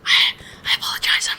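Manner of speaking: breathy whispered apology. Real, not acted. Game master's whispered apology.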